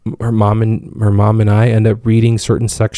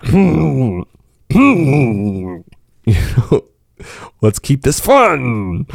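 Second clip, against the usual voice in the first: gentle; 3 semitones higher, much slower than usual. Retold or imitated. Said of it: "and I would be like".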